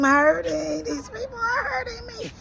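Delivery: high, cracking voice that breaks into crying; breaking into tears. Retold or imitated. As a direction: let the voice crack and sob on the last words. Retelling pleading to the therapist.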